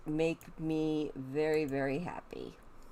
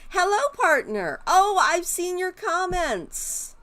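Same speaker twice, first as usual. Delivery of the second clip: excited greeting; 13 semitones higher, 6 dB louder than usual. Real, not acted. Greeting a raccoon outside with a loud high coo.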